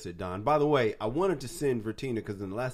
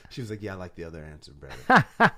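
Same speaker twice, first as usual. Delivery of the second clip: laughing; 3 semitones higher, 9 dB louder than usual. Real, not acted. Laughing retelling of ex-wife.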